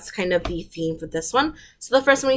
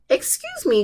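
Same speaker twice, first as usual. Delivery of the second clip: much higher little cricket voice. A narrator's reading. Cricket politely interrupting Gerald, high tiny voice. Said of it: "coughed a cricket".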